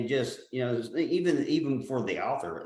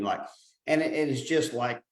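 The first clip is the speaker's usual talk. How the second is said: normal talking voice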